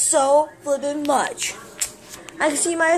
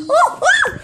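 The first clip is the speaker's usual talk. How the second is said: sudden high yelp of surprise, much higher and louder; startled yelp; 7 dB louder than usual. Real, not acted. Kid vlogger suddenly bumping himself, startled high yelp.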